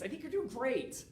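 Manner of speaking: sugary "doing great" that settles down; patronizing relative. Retold or imitated. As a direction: wrap up the condescending compliment. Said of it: "people are like".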